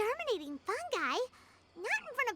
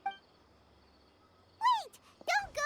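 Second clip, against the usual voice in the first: high, pleading shout after her; calling out; 8 semitones higher, much slower than usual. Acted. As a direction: call her back before she leaves. Calling after Hania leaving.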